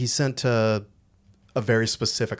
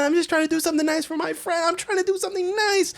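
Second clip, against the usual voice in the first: very high, strained pleading; frantic son begging his mom on the phone; whiny pleading son; 5 dB louder than usual. Retold or imitated. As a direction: beg mom in a high whine. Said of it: "She calls up her mom, like".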